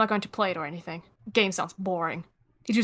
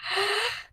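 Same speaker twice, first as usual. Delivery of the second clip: sharp gasp; 11 semitones higher, 5 dB quieter than usual. Pretend. Dramatic gasp.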